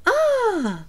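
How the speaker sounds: high, drawn-out "ah" that falls away; a high sighing "ah", sliding down; long "ah". Shown as a demonstration. Demonstrating sounds people make talking.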